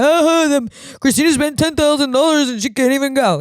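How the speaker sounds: high, loud teasing of the gossip posts; mocking gossip voice. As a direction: lampoon the friends tagging her online. Mocking people's IG stories.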